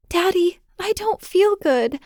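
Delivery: put-on high, small child's voice, unwell; a little girl who feels ill and frightened; sick scared girl. Daughter tells her father she feels sick, high and small.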